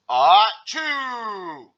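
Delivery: huge, drawn-out mock sneeze; giant sneeze. A narrator's reading. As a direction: explode high and loud, tailing off long. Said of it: "sneezed a giant sneeze".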